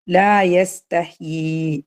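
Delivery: very slow, elongated madd; stretched tajweed chant; Quran recitation. Shown as a demonstration. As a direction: hold the long vowel out.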